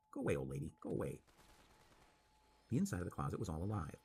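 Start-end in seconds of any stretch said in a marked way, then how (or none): 0.1-1.2 lower, flat doll quack; flat slapping doll voice. A narrator's reading. Doll drives the old lady away, low flat quack. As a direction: repeat it like a hateful wind-up toy. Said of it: "a flat, slapping voice".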